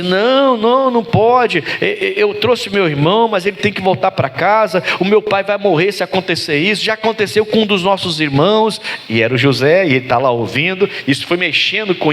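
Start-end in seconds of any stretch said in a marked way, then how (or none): 0.0-8.8 high-pitched, urgent retold plea; imitating an insistent, imploring protest; acted pleading. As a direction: plead as the desperate brothers, voice held high. Brothers plead with Joseph, acted.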